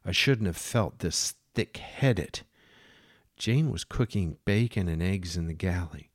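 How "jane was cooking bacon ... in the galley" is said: ordinary delivery, nothing marked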